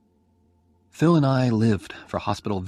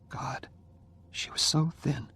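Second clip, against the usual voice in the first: plain reading voice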